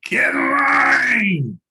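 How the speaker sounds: a long, slow, very high and loud shout at the pack mules; imitated drawn-out shout. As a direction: bellow it across the trail like a muleteer. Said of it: "I heard him say".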